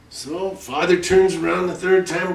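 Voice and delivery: usual speaking voice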